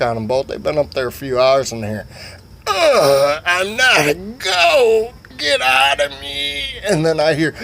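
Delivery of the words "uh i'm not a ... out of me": high, drawn-out mock wail; wailing protest. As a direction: wail each word out slowly in falsetto. Overheard cries from upstairs.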